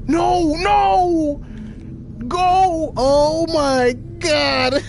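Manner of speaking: high, drawn-out desperate cries; panicked urging. Real, not acted. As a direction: beg it to move in panic. Desperate as game blocks movement.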